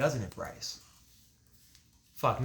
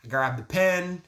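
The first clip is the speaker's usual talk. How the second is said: usual speaking voice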